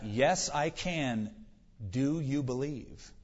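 unhurried, calm acted heavenly voice; deep, calm voice. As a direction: answer slowly and serenely, as God would. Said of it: "Deep, calm voice".

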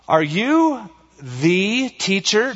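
slow, pointed sarcasm leaning on "the"; sarcastic. Acted. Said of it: "Can you hear the sarcasm in that?".

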